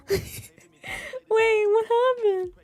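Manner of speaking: high, loud question through real laughter; squealed out mid-laugh. Real, not acted. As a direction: crack up while asking. Cracks up reacting to chat.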